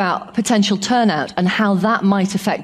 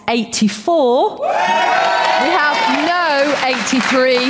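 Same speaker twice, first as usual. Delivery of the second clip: dramatic result reveal; 7 semitones higher, much slower than usual. Real, not acted. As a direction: stretch each word to build suspense, then call out the number. Announcing the live vote count.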